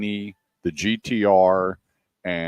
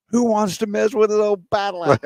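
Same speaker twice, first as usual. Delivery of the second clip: put-on much higher old lady voice, defiant; feisty old lady; 12 semitones higher than usual. Eighty-four-year-old driver answering radio, high feisty old voice. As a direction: throw it back at them with sass. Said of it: "And she goes".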